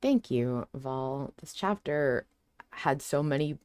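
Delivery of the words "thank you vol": normal talking voice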